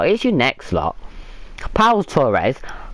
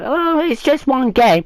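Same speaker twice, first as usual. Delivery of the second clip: mocking fan; 9 semitones higher, slower than usual. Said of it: "I don't wanna hear anyone go".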